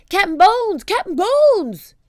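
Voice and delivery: high, loud chanted cry; a crew calling together; pirates shouting. Acted. Pirates shout for their captain, loud. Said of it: "took up the cry".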